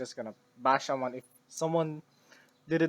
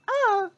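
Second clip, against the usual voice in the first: short high yelp; startled yelp; 8 dB louder than usual. Real, not acted. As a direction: yelp in surprise. Sudden startled cry.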